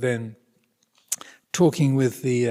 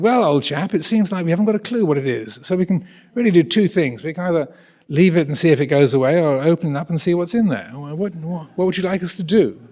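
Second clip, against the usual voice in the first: high, louder breezy public school military English; posh English accent; 5 semitones higher, 5 dB louder than usual. Retold or imitated. Consultant offering the patient two options, in posh English accent. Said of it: "I'm not exaggerating his accent".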